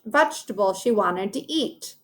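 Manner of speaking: plain reading voice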